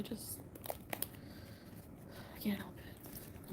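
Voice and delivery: slow, drawn-out whisper. Real, not acted. Confessing the cigarette to viewers in a whisper. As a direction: let it fade to breath. Smoking secretly at night, husband asleep.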